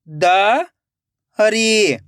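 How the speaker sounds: दरी split into द, long pause, री; rising द, then री alone; syllable by syllable. Shown as a demonstration. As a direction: sound out दरी very slowly in two parts. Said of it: "एक एक अक्षर का उच्चारण करना है".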